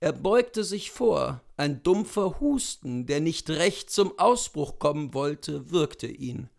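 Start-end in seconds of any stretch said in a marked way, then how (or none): none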